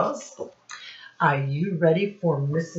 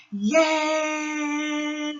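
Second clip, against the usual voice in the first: high excited yay; cheer; 11 semitones higher than usual. Shown as a demonstration. Teacher modelling a cheer for the class. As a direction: squeal it like a happy kid.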